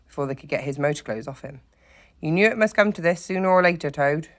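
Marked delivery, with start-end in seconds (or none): 2.2-4.3 severe. A narrator's reading. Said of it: "explained severely".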